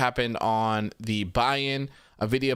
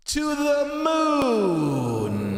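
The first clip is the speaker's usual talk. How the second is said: drawn-out hype; 11 semitones higher, 3 dB louder, much slower than usual. Acted. Reading a hype chat message.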